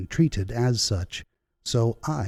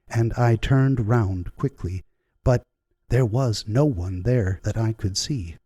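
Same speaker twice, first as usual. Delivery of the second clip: usual speaking voice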